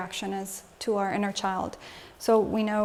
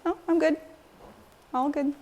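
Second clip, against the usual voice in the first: higher, breezy fake cheer; fake fine; 6 semitones higher, faster than usual. Shown as a demonstration. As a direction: brush it off as if all is well. Demonstrating a facade reaction.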